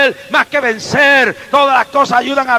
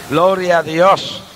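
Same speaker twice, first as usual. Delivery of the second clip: normal talking voice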